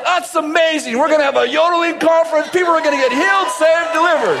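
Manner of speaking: high, excited proclamation; hammed-up preacherly enthusiasm; thrilled. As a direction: announce it like glorious news. God thrilled at a yodeling gift.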